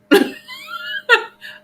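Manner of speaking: high squealing laugh that breaks free; burst of laughter. Real, not acted. Laughing loudly at her own comeback.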